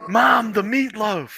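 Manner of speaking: high, slow yell to mom; calling out. Pretend. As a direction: call out across the house.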